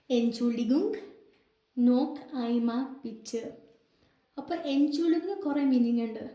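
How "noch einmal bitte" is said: very slow, each word set apart; slowly spelled-out classroom German; slow teaching demo. Shown as a demonstration. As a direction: model the phrase slowly for learners. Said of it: "teacher demonstrating German phrase".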